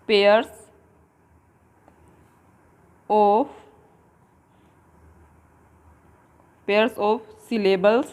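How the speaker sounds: each word set apart by long pauses; slow dictation. Shown as a demonstration. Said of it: "dictating definition word by word".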